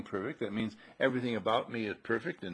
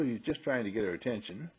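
ordinary talk

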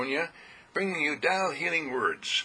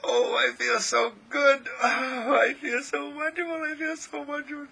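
elated self-talk; 11 semitones higher than usual. Acted. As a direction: gush to yourself in delight, pitched way up. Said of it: "Have you ever said to yourself".

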